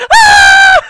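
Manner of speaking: shrill mock scream, high and loud; hammed-up scream of terror. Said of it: "he's screaming".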